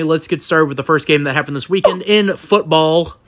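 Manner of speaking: normal talking voice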